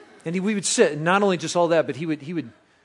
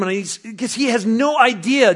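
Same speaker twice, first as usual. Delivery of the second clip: normal talking voice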